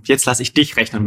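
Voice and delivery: ordinary talk, nothing marked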